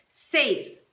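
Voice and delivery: loud umpire call; sharp, loud baseball call; umpire shout. A narrator's reading. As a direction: bark it like an umpire calling safe. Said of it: "shouted the umpire".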